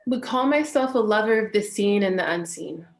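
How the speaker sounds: normal talking voice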